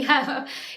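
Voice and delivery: a short laugh, caught out by her own emotion; embarrassed laugh. Real, not acted. Coach moved by her clients, laughing at herself.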